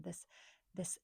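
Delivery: ordinary delivery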